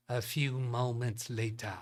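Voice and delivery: put-on slow, grand French-accented title-card voice; a playful, slowed French time-card read; mock French narrator. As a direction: announce it like the cartoon's French narrator. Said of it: "What accent is that meme?".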